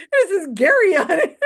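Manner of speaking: high and loud through laughter; can't hold it together on the punchline; laughing punchline. Real, not acted. Picturing the paper in his shoe, laughing loudly.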